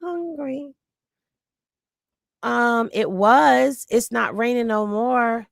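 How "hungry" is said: drawn-out, pitiful acted complaint; mock hungry misery. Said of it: "and you like".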